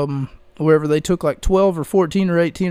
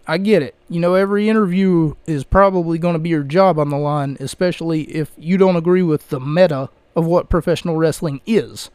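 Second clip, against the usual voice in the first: ordinary talk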